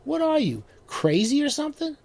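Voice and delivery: high, loud mocking yell; exasperated shout. A narrator's reading. Neighbor yelling at the monster through the wall, exasperated. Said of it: "the neighbor shouted".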